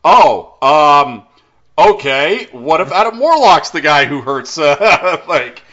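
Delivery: stall, then blurt the idea out; hesitant pitcher. Retold or imitated. The writer improvising a pitch, flustered. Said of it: "he goes".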